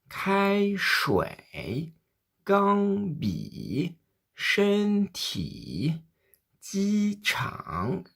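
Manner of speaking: Kai Shui, Gang Bi, Shen Ti, Ji Chang : syllabe haute puis bien descendre avant de remonter; premier et troisième ton modèle. Shown as a demonstration. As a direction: plonger au fond comme un manège de montagne russe. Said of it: "Deuxième fois". (modèle premier ton puis troisième ton qui descend et remonte)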